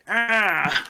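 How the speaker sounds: high mock "ah"; imitated "ah". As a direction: imitate his drawn-out high "ah". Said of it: "just went".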